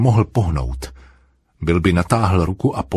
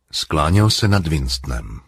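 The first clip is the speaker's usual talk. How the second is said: plain reading voice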